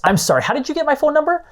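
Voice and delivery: high, fast, suspicious cut-in; curt interruption. Acted. Lead Kurt cutting off a cold caller, high and fast. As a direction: snap back at a stranger who has your number. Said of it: "Kurt again interrupts".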